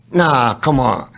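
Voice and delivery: ordinary talk, nothing marked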